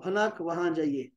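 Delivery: ordinary talk, nothing marked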